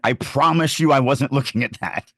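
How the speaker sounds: ordinary delivery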